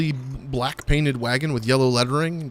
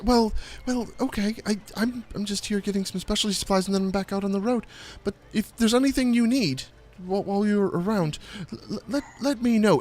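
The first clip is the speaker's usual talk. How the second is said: higher stammering halfling voice; Stefan's voice; 7 semitones higher, 4 dB quieter than usual. Acted. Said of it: "and he says".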